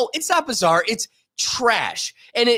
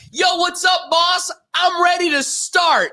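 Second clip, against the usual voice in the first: breezy mock greeting; 9 semitones higher than usual. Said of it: "I don't get to just walk in and be like".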